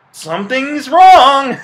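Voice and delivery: high, loud, drawn-out cry; mock horrified outburst. Reviewer on horror in superhero film, mock-alarmed cry. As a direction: wail it slowly, far above normal pitch.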